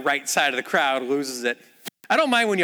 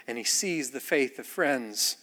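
normal talking voice

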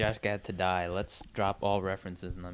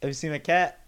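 casual quote; 7 semitones higher, 6 dB louder than usual. Retold or imitated. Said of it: "how does he say it?".

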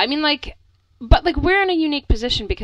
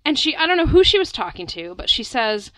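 ordinary talk, nothing marked

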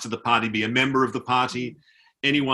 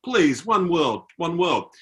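higher, earnest retold plea; imitating pleading soldier; 5 semitones higher, 3 dB louder, much slower than usual. Soldier turns back to tourist, imitated pleading. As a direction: appeal undeterred, as the soldier. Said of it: "went back to me saying".

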